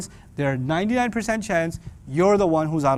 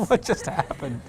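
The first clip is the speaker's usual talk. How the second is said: usual speaking voice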